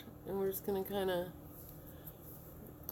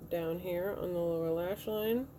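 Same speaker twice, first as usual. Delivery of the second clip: usual speaking voice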